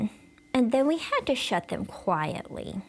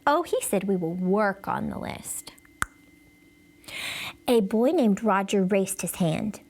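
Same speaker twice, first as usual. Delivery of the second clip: usual speaking voice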